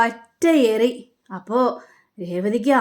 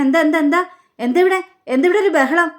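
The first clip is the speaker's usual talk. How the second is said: raised, higher voice demanding what is going on; alarmed calling out; 5 semitones higher, 3 dB louder than usual. Acted. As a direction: call out in alarm as you run into the room. Son runs in on quarrel.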